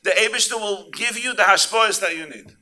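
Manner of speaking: loud, high fervent sermon voice; preaching. Acted. Rabbi preaching to the congregation, fervent and loud. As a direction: drive it home to the crowd.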